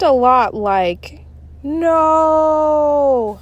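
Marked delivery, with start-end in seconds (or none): 1.6-3.4 stunned no. Acted. Narrator stunned by Oreo.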